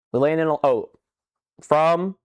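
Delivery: ordinary delivery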